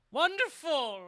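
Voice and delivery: high, loud theatrical proclamation; mock declaiming. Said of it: "he's declaring everything is wonderful".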